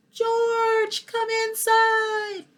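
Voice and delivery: calling out high and long, slow and drawn out; a mother calls George in from the yard, sing-song and high. Acted. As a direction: call the child home from the doorway.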